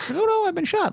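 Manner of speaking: very high, prissy robot wail; hammed-up C-3PO cry. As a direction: squeal it like a panicked protocol droid. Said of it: "C three P O".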